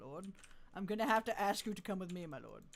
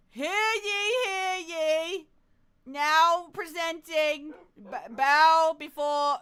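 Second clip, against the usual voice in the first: loud, higher, slow town crier call; herald proclamation; 7 semitones higher, 11 dB louder, much slower than usual. Pretend. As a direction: announce the lord like a royal herald. Herald announcing again.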